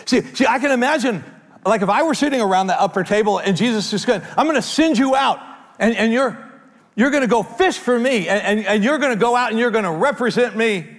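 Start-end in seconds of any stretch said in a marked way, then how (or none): 4.4-10.9 excited commission. Retold or imitated. Imagined Jesus commissioning, voiced loudly.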